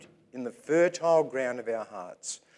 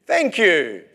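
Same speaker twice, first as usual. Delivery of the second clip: loud, raised-pitch call across the room; 6 semitones higher, 9 dB louder than usual. Real, not acted. Preacher thanking someone down the back, calling out. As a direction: project it loudly to the back rows.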